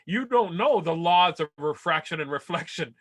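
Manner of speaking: ordinary delivery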